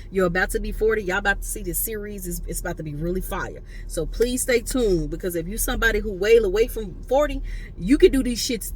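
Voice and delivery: normal talking voice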